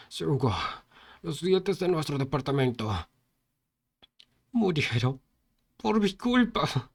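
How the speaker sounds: anguished, slowing guilt; a dying man's confession of guilt; dying lament. A narrator's reading. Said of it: "en sus últimos momentos". (lamento de un moribundo, con culpa)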